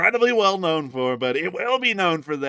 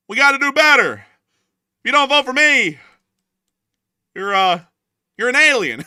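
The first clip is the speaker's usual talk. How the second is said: mock campaign threat; 8 semitones higher, 9 dB louder than usual. Candidate threatens voters who won't vote, loud mock rant. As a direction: bellow it like a ranting candidate.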